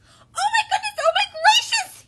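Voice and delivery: very high, loud squawky chicken panic; panicky chicken. A narrator's reading. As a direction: exclaim in a frantic little-chicken voice. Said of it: "he exclaimed".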